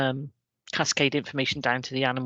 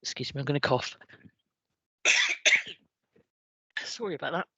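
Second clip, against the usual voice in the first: ordinary delivery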